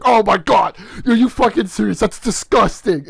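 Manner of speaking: teacher loudly scolding a student in the lunch line; angry teacher. Retold or imitated. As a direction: yell it at the kid, appalled. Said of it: "And the teacher's like".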